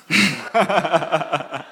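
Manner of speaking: a high, delighted burst of laughter; bright amused laugh; real laugh. Real, not acted. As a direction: laugh out at the memory. Laughs at the memory.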